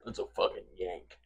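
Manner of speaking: low, muted Southern caricature; mock Southern accent. Southerner spotting a Yankee, mock Southern accent.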